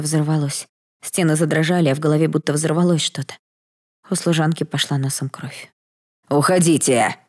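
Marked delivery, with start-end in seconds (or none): 6.3-7.2 a louder, frightening yell; a dying lord yelling at the maid to flee; terrible shout. Acted. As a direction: shout the order in a dreadful voice. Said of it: "страшным голосом закричал Виктор".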